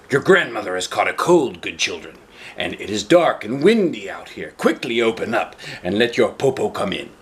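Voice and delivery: sly wolf voice. Acted. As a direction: coax them like a sly old wolf. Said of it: "the cunning wolf said".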